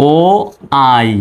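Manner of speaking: ও, pause, then আই; the glide split into ও ... আই; broken into sounds. Shown as a demonstration. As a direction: sound out ও and আই separately. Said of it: "ওয়াই উচ্চারণ দিচ্ছে".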